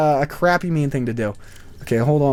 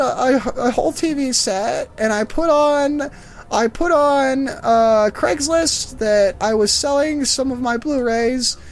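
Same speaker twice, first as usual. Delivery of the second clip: high, slow old lady impression; put-on elderly voice, pitched up; elderly woman; 7 semitones higher, slower than usual. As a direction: mimic an old woman telling her woes, drawing words out. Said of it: "it's this old elderly woman".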